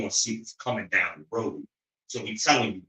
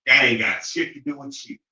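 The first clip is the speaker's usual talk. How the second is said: ordinary talk, nothing marked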